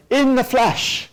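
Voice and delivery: "in the flesh" jumps high and loud; stress on in the flesh. Retold or imitated. Lecturer voicing theologians who insist, emphatic in the flesh. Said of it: "they keep wanting to say".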